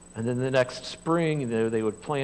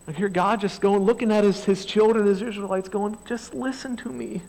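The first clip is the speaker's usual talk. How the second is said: loud, high-pitched sermon delivery; full-force preaching; 8 semitones higher, 7 dB louder than usual. Acted. Pastor driving the story home to the congregation, loud preaching. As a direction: preach it out to the whole room.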